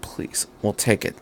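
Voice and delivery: soft whispered mutter. A narrator's reading. Misato muttering to herself, whispered. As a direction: murmur it to herself. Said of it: "she muttered".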